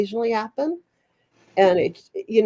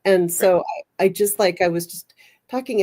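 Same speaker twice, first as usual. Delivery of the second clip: ordinary talk, nothing marked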